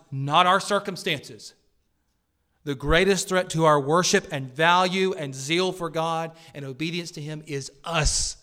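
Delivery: loud, urgent sermon climax; preaching at full force. Real, not acted. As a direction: build it up and drive it home to the congregation.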